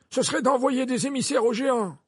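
high-pitched, assertive instruction; firm proposal. Acted. Dumbledore firmly proposes the measure.

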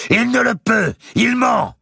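a very high, loud accusing cry; outcry. A narrator's reading. Mao shouting that Robert lies, very high and loud. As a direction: shout him down as a liar. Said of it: "s'écria Mao".

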